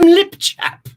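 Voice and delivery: lip chap in a high, posh-joking British accent; playful mock British accent for lip chap. Shown as a demonstration. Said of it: "as they call it in the United Kingdom".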